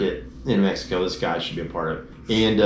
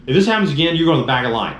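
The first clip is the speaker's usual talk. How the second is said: stern warning; 6 semitones higher, 4 dB louder, much faster than usual. Retold or imitated. Border officer scolding them.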